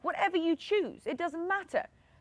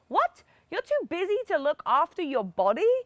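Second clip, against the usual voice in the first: incredulous what; 3 semitones higher, 4 dB louder than usual. Acted. Incredulous reaction to busy excuse.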